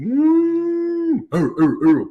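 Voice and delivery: high, hooting ooh like a stadium crowd; crowd ooh imitation. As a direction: mimic the crowd reacting to an entrance. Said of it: "That was pretty good".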